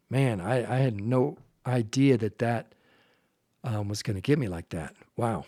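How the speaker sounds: a father fighting back tears, trailing off quietly; choked up. Real, not acted. Telling podcast listeners about his son, quietly choked up. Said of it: "sorry, I'm still choking up".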